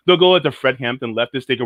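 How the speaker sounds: ordinary talk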